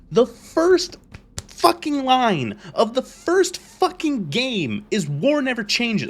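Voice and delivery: high, loud emphatic rant; angry rant. Real, not acted. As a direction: hammer the point home. Ranting about the intro line.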